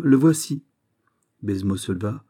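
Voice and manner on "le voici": raised, louder and firm. A narrator's reading. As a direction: deliver it firmly, no hesitation. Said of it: "d'une voix ferme".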